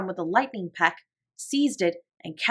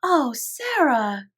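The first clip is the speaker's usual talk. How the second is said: put-on high, slow little girl's cry; Lottie crying out; 6 semitones higher, much slower than usual. A narrator's reading. Lottie cries out to Sarah, high and slow. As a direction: stretch the oh like an excited small child. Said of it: "cried Lottie".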